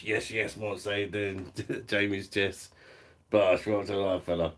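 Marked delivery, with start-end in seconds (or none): 3.3-3.7 a punchy, loud bosh. Real, not acted. Cheering a viewer on the live, a loud bosh.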